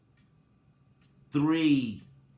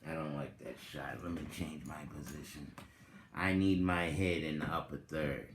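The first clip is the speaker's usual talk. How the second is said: fast and much softer, to himself; quick muttered aside; 8 semitones lower, 9 dB quieter, much faster than usual. Real, not acted. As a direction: rush it under your breath, fixing the camera. Fixing camera shot aside.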